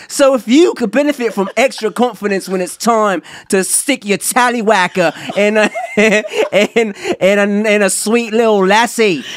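hammed-up Cockney ad read; mock British accent, playful. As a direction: play a cheeky London geezer selling pills. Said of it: "Does the accent come with the Blue Chew?".

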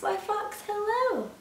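high sing-out greeting; cheery mouse. Acted. Mouse greets fox beside the Gruffalo, cheery. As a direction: greet in a high, innocent lilt.

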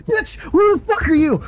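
high, loud panicked shriek; terrified scream. Real, not acted. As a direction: scream it the instant you're scared. Screams at a jump scare.

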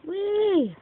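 high gleeful squeal; whee. Real, not acted. Gamer leaping in parkour, squealing. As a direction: squeal with delight as you leap.

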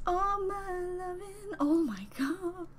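breathy whispered echo of the song line, slow. Real, not acted. Echoing the song to herself, awed whisper. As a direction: whisper it to yourself, awestruck.

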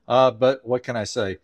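normal talking voice

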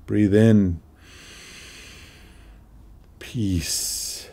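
slow meditation voice with long pauses; measured, spacious meditation leading in short phrases; guided meditation. Acted. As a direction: guide listeners through each breath, unhurried, letting phrases settle.

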